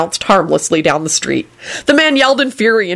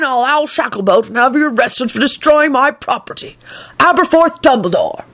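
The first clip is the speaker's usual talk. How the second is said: high, prim reading of a snippy notice; Aberforth's sign; 9 semitones higher than usual. A narrator's reading. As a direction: read the warning with indignant pomp. Sign read in voice.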